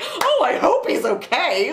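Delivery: high-pitched, hammed-up concern; mock worried relative. Mimicking the family watching news, mock worry. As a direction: put on a helpless fretting voice.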